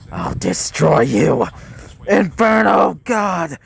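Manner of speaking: screamed attack call. A narrator's reading. Dogen unleashes a new head's attack, slow villainous scream. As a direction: build from threat to drawn-out battle cry. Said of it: "as he would scream out".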